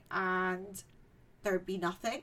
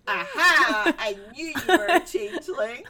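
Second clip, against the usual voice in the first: triumphant mum; 2 semitones higher, 8 dB louder than usual. Retold or imitated. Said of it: "then the mum goes".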